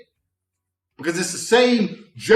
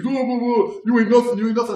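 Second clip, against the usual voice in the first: higher, louder taunt; mock heckler taunting; 7 semitones higher, 3 dB louder than usual. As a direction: jeer like a bar loudmouth. Heckler talking shit, imitated.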